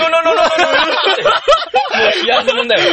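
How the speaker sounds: high, loud, real laughter through the words; cracking up. Real, not acted. Guest confessing the language slip to friends, cracking up. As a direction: barely get the story out for laughing.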